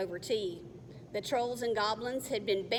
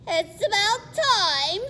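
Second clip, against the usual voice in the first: higher, louder, slow rasp; high raspy goblin; 10 semitones higher, 9 dB louder, slower than usual. Acted. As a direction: sneer it like an impatient goblin. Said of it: "the high-pitched, raspy voice of the taller".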